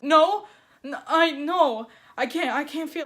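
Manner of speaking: rising alarm, stumbling over the words; panicked. Retold or imitated. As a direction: stammer out the panic, voice up high.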